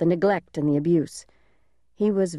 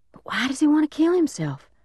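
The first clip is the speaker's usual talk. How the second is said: put-on high-pitched child's voice, rising on the question; puzzled little boy; 9 semitones higher than usual. A narrator's reading. Little brother questioning big brother about the man, high puzzled voice. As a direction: ask it high, like a bewildered kid.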